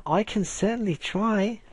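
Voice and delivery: high, slow British lady voice; girly British accent. Shown as a demonstration. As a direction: try a girlish British lilt. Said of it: "I can't really sound girly with it".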